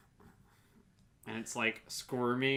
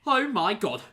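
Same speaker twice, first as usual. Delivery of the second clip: shaken relief; 8 semitones higher, 7 dB louder than usual. Acted. Rescued victim recovering from fright.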